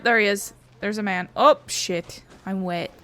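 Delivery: ordinary talk, nothing marked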